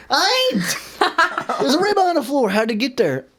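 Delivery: high-pitched imitated denial; feigned innocence. Caught shoplifter answers clerk about dropped ribeye, feigned innocence.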